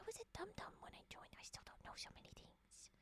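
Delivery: ASMR whisper; soft ASMR whisper to chat. Real, not acted. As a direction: confide it in a breathy hush.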